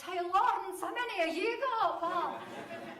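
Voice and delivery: loud schoolboy heckle from the back; shout. Retold or imitated. Classmate Davy shouting a jibe at the teacher, loud. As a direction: yell it across the classroom. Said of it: "he shouts".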